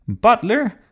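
loud, high call for a servant; storybook queen's shout. A narrator's reading. Queen summoning her butler, shouting. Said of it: "shouted the Queen".

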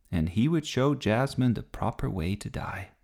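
plain reading voice

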